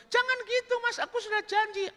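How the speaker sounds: very high falsetto coaxing; coaxing woman. Acted. Woman coaxing Burhan to remember her, high falsetto. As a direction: coax him in high falsetto.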